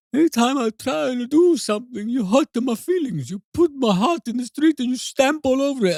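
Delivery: high, whining, over-the-top Italian caricature; a man complaining his father hurts his feelings, in a hammed-up high Italian voice; mock Italian accent. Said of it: "He's like".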